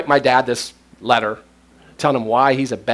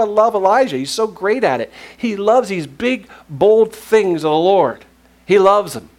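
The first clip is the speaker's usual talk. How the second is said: enthusiastic; 7 semitones higher, 4 dB louder than usual. Real, not acted. Preacher builds excitement.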